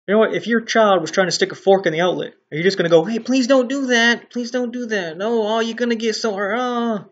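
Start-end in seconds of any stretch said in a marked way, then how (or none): 3.0-7.0 mock gentle parent. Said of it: "be all kind and gentle about it".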